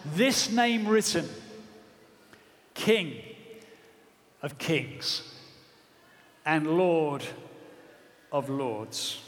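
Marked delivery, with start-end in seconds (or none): 2.7-9.2 each word set apart, dropping low; solemn proclamation. Acted. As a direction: pause between King of Kings and Lord of Lords.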